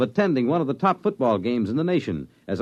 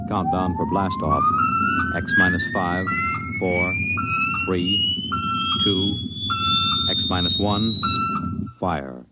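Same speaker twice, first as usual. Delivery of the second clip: distant, radio-filtered countdown call; launch countdown over radio. Acted. Mission control counts down the rocket launch, filtered.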